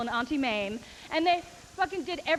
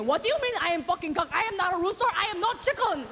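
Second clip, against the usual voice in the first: put-on higher, indignant Korean accent; mock Korean accent protesting the insult; Korean man accent; 6 semitones higher, 3 dB louder than usual. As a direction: play the baffled old Korean man, Korean accent. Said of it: "call a fifty-year-old Korean man".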